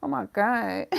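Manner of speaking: inner voice about to cry. Retold or imitated. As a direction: act out holding back tears, half-joking. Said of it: "you still think about".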